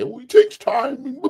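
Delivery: high, loud, drawn-out mock wail; slow mocking whine that breaks into noise. Imitating fans making excuses for the club, mocking whine.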